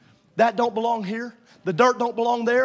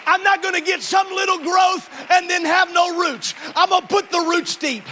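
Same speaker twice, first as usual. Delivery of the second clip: loud, high, slowed exhortation; full-force preaching; 9 semitones higher, 6 dB louder than usual. Real, not acted. Preacher pressing the congregation to face their problem, loud. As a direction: drive the point home from the pulpit.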